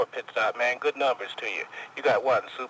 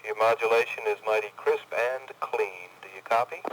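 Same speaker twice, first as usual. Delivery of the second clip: over cb radio. Acted. Distant CBer reply over radio.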